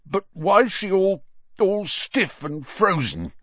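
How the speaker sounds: high, halting, choked question. A narrator's reading. Caretaker asking about his stiffened cat, choked up. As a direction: stumble through it in a high, tearful voice. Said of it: "choked Filch".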